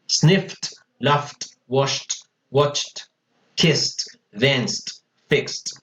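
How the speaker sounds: "snift, laft, washt, watcht", one syllable each. Shown as a demonstration. Teacher drills voiceless endings, SNIFFED to FIXED ending in T. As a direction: list of words each ending in a crisp T. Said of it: "la E D la pronuncio como una T".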